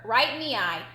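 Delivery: ordinary delivery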